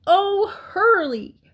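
high, drawn-out frantic plea; loud, anxious begging; urgent pleading. A narrator's reading. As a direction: urge them on in a high piglet voice. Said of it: "said Wilbur".

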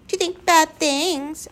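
high, slow sing-song echo; mocking echo. A narrator's reading. Gaboon echoing Lindy's scolding, mocking. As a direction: parrot it back, drawn out. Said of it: "echoed the gaboon".

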